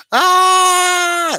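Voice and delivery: long, loud falsetto scream; high-pitched scream. Acted. Storyteller mimics the condemned being punished, long shrill scream. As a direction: imitate someone screaming their brains out. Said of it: "screaming their brains out".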